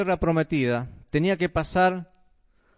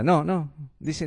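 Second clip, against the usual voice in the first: normal talking voice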